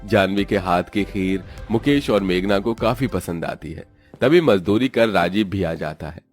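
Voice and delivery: usual speaking voice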